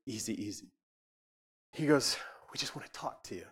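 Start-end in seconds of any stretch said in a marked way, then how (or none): 0.0-0.7 very soft, low imitation of calming someone; quiet, low reassurance, acted out; imitated soothing interpreter. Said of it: "this is how he said it". 2.5-3.5 hushed, confiding imitation; soft, gentle retold plea; imitated soothing interpreter. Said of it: "this is how he said it".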